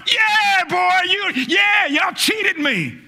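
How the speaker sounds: mocking, drawn-out jeer; a hint of spiteful gloating; gloating taunt. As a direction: taunt those who cheated you. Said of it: "looking back at 'em going".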